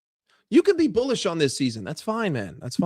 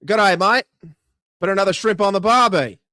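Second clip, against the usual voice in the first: hammed-up Aussie accent, slightly raised; cheery stock-phrase Australian accent; 3 dB louder than usual. Shown as a demonstration. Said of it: "I find Australian very difficult".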